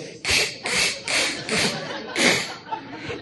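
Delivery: a long, silent, breathy fit of laughter; breathless laugh. Real, not acted. Telling the host about the cyclist, helpless laughter. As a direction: laugh with no voice left. Laughing at his own story.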